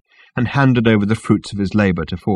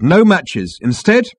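raised, emphatic declaration; firm; 9 semitones higher, 5 dB louder, slower than usual. A narrator's reading. Ford corrects the captain, firmly. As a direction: announce it firmly. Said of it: "be a little stronger on the subject".

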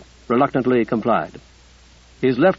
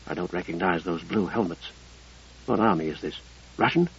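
soft urgent hiss; hissed whisper; 8 semitones lower, 10 dB quieter than usual. A narrator's reading. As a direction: whisper it tensely under breath. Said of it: "Josh hissed to Ruddy".

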